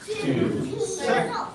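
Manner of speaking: shouted drill count. Real, not acted.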